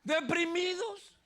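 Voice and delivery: mock moan. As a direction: drag the word out in mock gloom. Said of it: "mocking depressed tone". (quejido burlón y lento)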